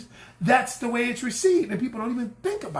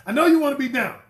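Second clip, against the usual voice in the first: ordinary talk, nothing marked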